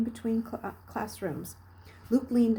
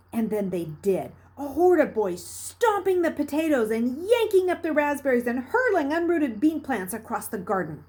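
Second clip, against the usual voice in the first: usual speaking voice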